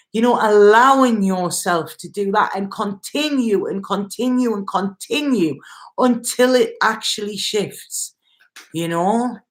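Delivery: normal talking voice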